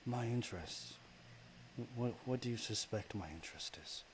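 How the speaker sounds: a hint of a haughty deity; goddess voice. Goddess of darkness belittles a mere mortal, scornful. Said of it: "I gotta do this voice again".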